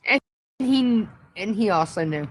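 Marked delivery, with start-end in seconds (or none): none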